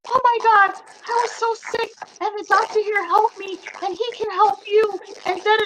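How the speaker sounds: high, eager gushing voice; excited girl's voice. Retold or imitated. Retelling an eager store girl, high and gushing. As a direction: gush it out like a girl who can't stop talking. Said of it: "she's like".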